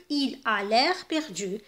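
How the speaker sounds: very slow, clear read of il a l'air perdu; slow pronoun version. Shown as a demonstration. Teacher giving the pronoun version, very slowly. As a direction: stretch it out for the pupils. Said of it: "إذن نقولوا".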